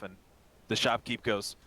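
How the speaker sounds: ordinary talk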